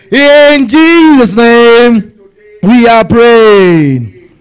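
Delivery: slow, stretched closing of the prayer; slow solemn prayer ending; drawn-out closing. Acted. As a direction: draw out every word to seal it. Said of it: "drawn-out closing formula".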